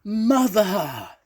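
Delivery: high, loud, long cry; calling out. A narrator's reading. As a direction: call across a crowd to a lost mother. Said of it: "a voice called out from the crowd".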